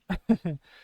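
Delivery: bright burst of laughter; amused laugh. Real, not acted. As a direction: laugh at your own mistake. Laughs at herself.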